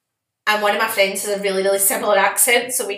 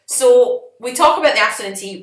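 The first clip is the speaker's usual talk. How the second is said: ordinary talk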